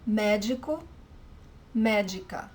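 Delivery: médico and médica with stress on MÉ, d as j; Portuguese pronunciation. Shown as a demonstration. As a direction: model médico, médica for learners.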